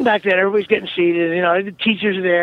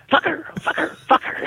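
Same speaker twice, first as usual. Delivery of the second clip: imitating a kid's outburst; 9 semitones higher than usual. Comic mimicking another kid on the plane, high mock blurting. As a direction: blurt it like a kid who can't stop. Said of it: "I hear someone say".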